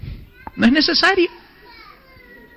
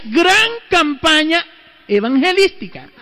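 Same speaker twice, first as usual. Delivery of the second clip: poster announcement; 6 semitones higher than usual. Retold or imitated. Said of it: "Decía".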